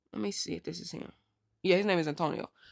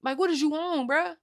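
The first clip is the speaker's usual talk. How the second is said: incredulous scolding; 8 semitones higher than usual. Retold or imitated. L C scolding Junior.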